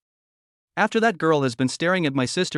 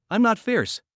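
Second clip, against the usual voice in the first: higher, muffled and reluctant; 7 semitones higher, slower than usual. Acted. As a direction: mumble it grudgingly, higher. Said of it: "in a muffled voice".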